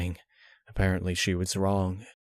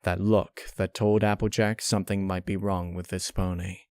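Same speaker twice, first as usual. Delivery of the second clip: ordinary delivery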